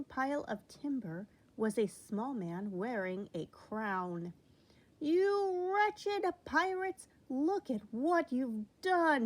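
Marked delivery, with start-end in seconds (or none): 5.0-9.3 high, loud, slow furious scolding; angry cry. A narrator's reading. King to the pirates, loud angry scolding. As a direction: berate the pirates for wrecking his ship. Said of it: "he cried".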